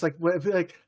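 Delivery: ordinary talk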